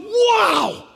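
high, astonished exclamation; wide-eyed, high-pitched wonder; acted amazement. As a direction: marvel as if just arrived in heaven. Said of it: "You're going".

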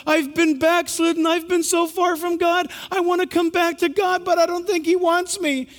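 put-on high, pleading voice of a young woman near tears; retold tearful plea. Young lady tells the pastor she feels far, tearful plea. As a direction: plead as if about to cry. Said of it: "She started crying".

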